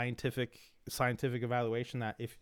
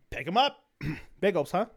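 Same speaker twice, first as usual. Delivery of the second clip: much higher and louder hype call; hyped shout; 6 semitones higher, 8 dB louder than usual. Retold or imitated. Friend hyping him up, higher and louder. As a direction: yell it like a coach at the bar.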